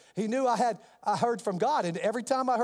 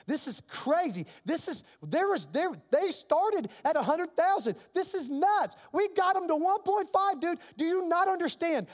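imitating an exasperated attorney, high and insistent; high-pitched mock tirade, trying to reason; irritated, mad; 9 semitones higher than usual. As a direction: argue it out, voice pitched way up. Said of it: "he's irritated with me. He's mad".

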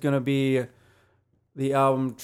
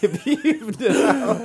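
laughing; 11 semitones higher, 6 dB louder, slower than usual. Real, not acted. Joking about beeves.

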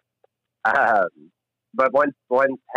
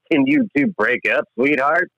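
mock teacher; 9 semitones higher, slower than usual. Mocking the teacher's reply.

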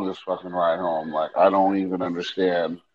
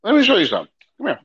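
menacing parent; 10 semitones higher, much faster than usual. Retold or imitated. Mocking parent, repeated louder.

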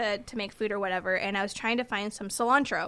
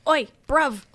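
mock British greeting; 3 semitones higher, 5 dB louder than usual. Imitating British slang greeting.

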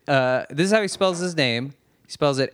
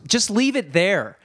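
higher, drawn-out scolding; mock yelling; 5 semitones higher than usual. Imitating counter girl ordering customer about tray, drawn-out scolding. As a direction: snap at a customer in an imitated mild yell. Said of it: "yelled at me".

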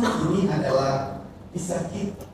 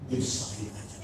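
unvoiced, much softer mutter; grumbled under breath; 8 semitones lower, 11 dB quieter than usual. Parrot mutters the wives' complaint to the pastor, whispered. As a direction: mumble it like a sulky parrot.

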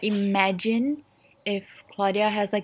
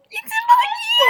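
very high, drawn-out loud squeal; playful shrieked mock singing; exuberant outburst; 5 dB louder, slower than usual. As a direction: belt out the name like a song hook. Bursts out with the Claudia song.

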